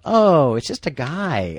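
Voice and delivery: lightly imitated woman's reaction; high "oh", then slowing down, a hint of a woman. Woman looking down at guy under the sink, lightly imitated voice. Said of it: "this woman's voice go".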